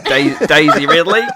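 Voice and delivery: loud, laughing Aussie attempt; self-conscious, loud Aussie accent, rising at the end. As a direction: try Australian through a laugh. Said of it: "wants me to do an Aussie accent".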